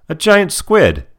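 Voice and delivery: bright cartoon-kid exclamation; mimicking Phineas. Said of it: "And Phineas says".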